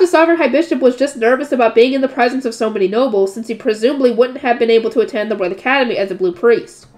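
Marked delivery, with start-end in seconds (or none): none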